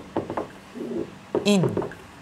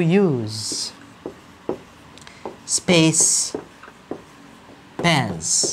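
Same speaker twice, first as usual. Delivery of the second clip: slow dictation; much slower than usual. Shown as a demonstration. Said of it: "هكتب الجملة زي ما هي".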